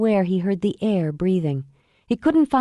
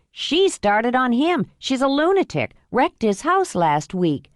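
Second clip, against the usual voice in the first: a hint of the protective buddy; lightly voiced friend Mike; 6 semitones higher than usual. Mike defends Noah.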